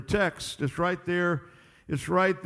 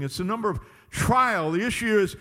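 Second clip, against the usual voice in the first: usual speaking voice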